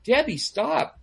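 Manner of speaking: high, fed-up reassurance; mock exasperation. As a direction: plead to be left alone, playing it up. Acted reply to persistent nagging.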